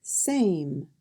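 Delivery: usual speaking voice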